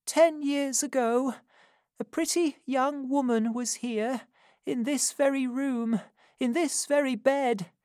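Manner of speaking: put-on high old crone voice, confessing; an old woman unburdening a secret; dying old woman. A narrator's reading. Old Sally confessing to Missus Corney, high crone voice.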